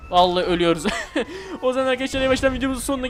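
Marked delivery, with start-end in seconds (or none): 0.9-1.6 laughing after crashing; high laugh. Real, not acted. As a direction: burst out laughing.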